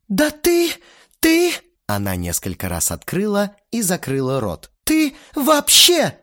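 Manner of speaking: high, loud, choked outburst; sputtering rage. A narrator's reading. Lena splutters in rage at Vova, loud and high. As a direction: splutter with fury, stretch the last.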